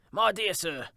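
animated Scrooge, calling out; effusive greeting. Acted. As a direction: greet him with eager delight. Scrooge eagerly greets old gentleman.

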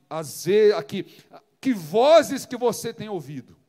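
loud, impassioned sermon delivery; full force preaching. Acted. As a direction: preach it to the congregation with fire. (pregação inflamada e intensa)

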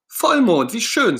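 put-on high, loud childlike puppet voice; a thrilled kid gasping at the moon; Ernie's delight. Ernie to Bert, delighted by the full moon. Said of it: "sagt Ernie".

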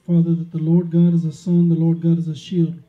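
loud, fervent preaching prayer. Real, not acted. Preacher opening the sermon with loud fervent prayer. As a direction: pray it out loud and strong.

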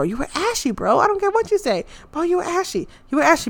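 playful mock insult; teasing jab. Real, not acted. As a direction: tease him like a buddy. Teasing Reginald about outfit.

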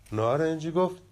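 ordinary delivery

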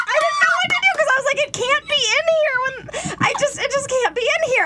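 high fast panicked voice; frantic. Retold or imitated. Telling friends how she panicked, high and frantic. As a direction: rush it, panicking.

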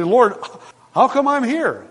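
high, wondering question to God; amazed question. Pretend. Preacher imagining arriving in heaven, high amazed question.

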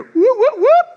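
high loud siren yelps; imitated police siren whoop. Preacher imitates police siren on the road, loud and high. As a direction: mimic a cop siren loudly, high up. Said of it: "I hear".

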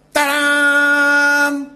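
long, level fanfare "ta-daaam"; triumphant ta-da. Acted. Preacher reveals Moses pulling out the staff, triumphant fanfare. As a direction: hold the last syllable like a trumpet flourish.

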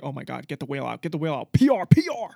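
frantic, rising to a yell; panicked. Retold or imitated. Imagined crew panicking.

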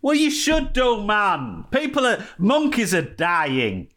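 high, mock-indignant protest, slowing on the last words; mock outrage. Host protesting to his co-host about palm oil, in a mock outraged high voice. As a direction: pretend horror at dying monkeys.

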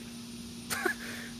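breathless high giggle; airy high laugh. Real, not acted. Laughing at himself about the weight, airy high giggle.

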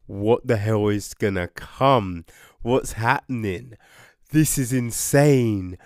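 ordinary talk, nothing marked

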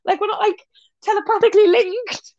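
high, incredulous protest; comic indignation. Acted. Asked about others with bipolar, comically indignant. Indignant reply to absurd assumption.

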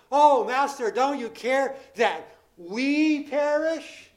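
loud, slow frightened cry with the stress on "we"; fearful plea. Retold or imitated. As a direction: cry out "we" as if only your own skin matters.

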